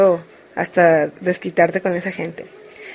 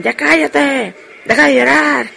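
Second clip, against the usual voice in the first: higher-pitched mock yell of annoyance; exasperated telling-off; 5 semitones higher, slower than usual. Everyone else yelling at the crying nephew, mock exasperated yell. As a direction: imitate fed-up relatives scolding a crying child.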